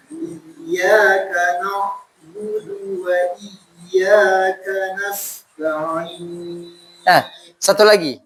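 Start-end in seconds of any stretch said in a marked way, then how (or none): 0.1-6.7 slow, chanted tajwid recitation; Quran recitation. Shown as a demonstration. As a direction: recite the verse slowly, melodically.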